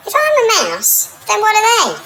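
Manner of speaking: high, teasing tiger voice; high mocking tiger. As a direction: tiger taunting the others from up high.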